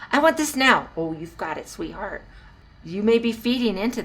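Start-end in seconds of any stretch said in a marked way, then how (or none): 0.1-0.9 put-on higher, insistent kid's demand; demanding child. Child bossing a permissive parent, demanding. 1.0-2.2 soft, indulgent parent giving in; sweetie parent. Acted. Permissive parent to demanding child, softly indulgent. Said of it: "if you're like, "Sweetie, sweetie, sweetie parent".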